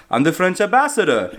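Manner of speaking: awful fake French accent. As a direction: do a bad French accent like a cartoon frog. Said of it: "awful fake accent that makes him sound like a cartoon frog".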